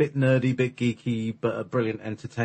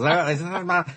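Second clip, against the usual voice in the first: German accent; 7 semitones higher, 6 dB louder than usual. Shown as a demonstration. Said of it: "this kind of choked up voice".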